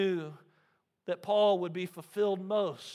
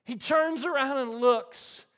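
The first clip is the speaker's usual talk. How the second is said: ordinary delivery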